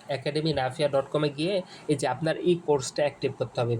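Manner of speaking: ordinary talk, nothing marked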